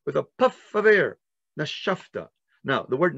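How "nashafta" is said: "Nashafta." breathed out softly; soft exhaled Nashafta; breathy exhale. Shown as a demonstration. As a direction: puff the air through "Nashafta.". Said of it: "makes you breathe".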